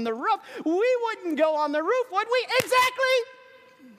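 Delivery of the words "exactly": excited. Acted. Preacher excited climax.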